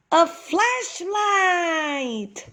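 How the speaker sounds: high, slow, drawn-out joyful cry; a thrilled shout of a find; excited cry. Acted. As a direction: cry it out like a great discovery. Discovery cry in the dark cave.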